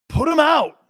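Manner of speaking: high, loud rallying yell; fired-up shout. Real, not acted. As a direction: bark the order like a coach at kickoff. Fired-up pep talk to team.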